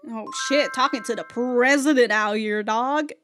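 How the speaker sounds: ordinary delivery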